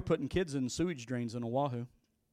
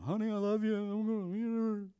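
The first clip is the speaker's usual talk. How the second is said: tender goodbye; 5 semitones higher, slower than usual. Retold or imitated. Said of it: "I'm called to".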